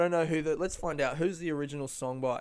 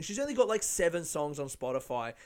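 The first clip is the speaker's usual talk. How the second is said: ordinary delivery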